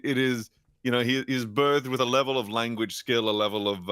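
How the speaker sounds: usual speaking voice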